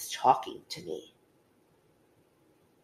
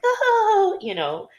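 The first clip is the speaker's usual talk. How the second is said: giddy crush; 7 dB louder, much slower than usual. Retold or imitated. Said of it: "that older cousin that was kinda like".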